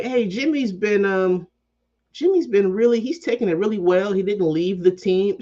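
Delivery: high, put-on voice mimicking gushing fans; mock naive fan. Podcaster mocks Jimmy's fans in a high, sappy voice. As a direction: lampoon the fans defending Jimmy. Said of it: "They're like".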